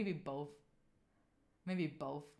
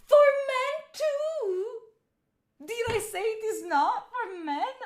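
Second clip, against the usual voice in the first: high, loud, slow indignant mimicry; mocking a guy; 12 semitones higher, 9 dB louder, slower than usual. Streamer imitating a guy's indignant reply, mocking and slow. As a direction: parody a defensive guy, stretched out. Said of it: "And a guy".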